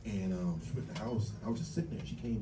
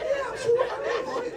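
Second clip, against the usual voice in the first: high and louder, giggling it out; laughing quote; 4 dB louder than usual. Retold or imitated. To the suspicious bank, high laughing retort. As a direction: squeak it through a laugh. Telling the bank it's his money.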